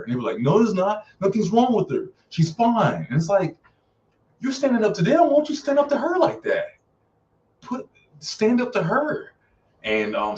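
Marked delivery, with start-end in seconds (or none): 0.4-3.1 high, insistent imitation of denial; imitated defensive boyfriend, voice raised high; mock defensive denial. Voicing boyfriend defending her to friends. 4.4-9.3 very high, incredulous protest; exasperated, voice pitched way up; exasperated scolding. Real, not acted. Exasperated at the spineless character.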